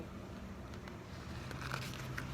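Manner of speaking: long unvoiced gasp; breathy gasp. Reading to children, a hushed gasp before the door opens. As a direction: draw in a breath in suspense.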